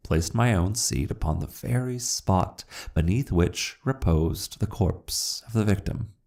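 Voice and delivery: ordinary delivery, nothing marked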